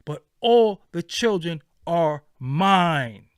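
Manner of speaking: mine said slow and loud; slow emphatic. Real, not acted. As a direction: land it like a declaration. Passionate claim over children.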